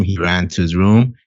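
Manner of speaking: usual speaking voice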